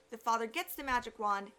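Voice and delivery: ordinary delivery, nothing marked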